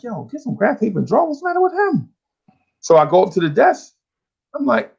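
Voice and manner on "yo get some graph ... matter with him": baffled schoolkid, climbing high; retold classroom incredulity; retold bafflement. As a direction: ask in high-pitched mock disbelief. Class baffled by teacher's order.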